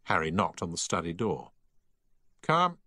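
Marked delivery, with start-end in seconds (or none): 2.4-2.8 one clipped, loud, higher word; curt command. A narrator's reading. Housemaster calls the boy into his study, curt. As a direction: summon him in without wasting a word. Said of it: "a man who never wasted words".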